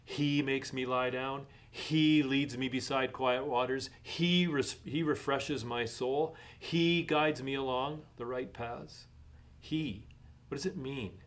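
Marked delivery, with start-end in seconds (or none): 0.1-9.1 each "He" pushed forward at the start; stress on he. Shown as a demonstration. Pastor points out the third-person pronouns, stressing each "He". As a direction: hit every "He" to show the pronouns. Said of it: "notice all the third-person pronouns".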